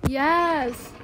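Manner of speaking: loud, celebrating yells; triumphant shout. Real, not acted. Celebrating in the game.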